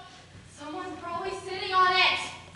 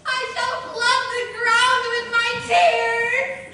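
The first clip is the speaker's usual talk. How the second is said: melodramatic; 5 semitones higher, 7 dB louder, slower than usual. Acted. Melodramatic poor girl wailing.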